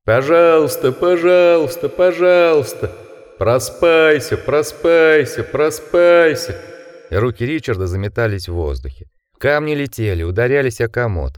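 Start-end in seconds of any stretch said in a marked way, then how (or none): 0.1-6.6 higher, louder, very slow mocking chant; mock like beasts at the window; inhuman chorus. A narrator's reading. Monster chorus mocking outside.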